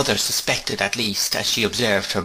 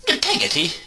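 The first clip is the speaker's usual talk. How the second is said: higher, indignant echo of the name; 8 semitones higher than usual. A narrator's reading. Miss Betsy repeating the servant's name, indignant. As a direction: repeat the name in mild outrage. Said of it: "with some indignation".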